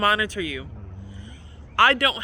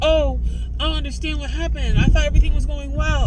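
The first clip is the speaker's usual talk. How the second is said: mock quote; 6 semitones higher, 6 dB louder than usual. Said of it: "Y'all gonna be like".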